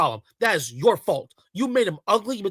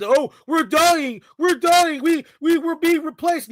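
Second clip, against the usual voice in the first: high, mocking wail of alarm; mock panicked complainers; 6 semitones higher, 3 dB louder than usual. Commentator mimics men who complain to him daily, mock-panicked. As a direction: mimic men whining that doom is coming. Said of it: "men that sit there and tell me every freaking day".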